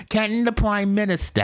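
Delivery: high, lilting British parliament question; mock British MP. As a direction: ask it like a pompous MP. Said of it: "I just love the way they speak".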